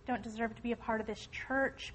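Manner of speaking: ordinary talk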